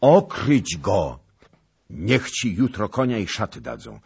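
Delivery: loud, high orders; commanding shout. A narrator's reading. King ordering clothes and horse for the knight, commanding shout. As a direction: call out commands for all to hear.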